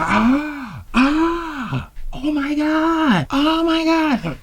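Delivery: hammed-up falsetto gasps of shock; mock shocked shrieks. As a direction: imitate horrified onlookers in a squeaky high register. Bystanders shriek as chair collapses.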